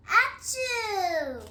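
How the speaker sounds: big loud acted achoo; loud sneeze. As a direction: sneeze it out hard. Gerald sneezes again.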